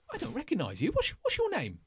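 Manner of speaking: high, sharp questioning guard; suspicious guard. Acted. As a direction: pitch it up, demand a name.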